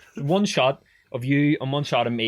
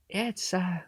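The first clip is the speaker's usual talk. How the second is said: imitating the teacher; 10 semitones higher than usual. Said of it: "and he was going".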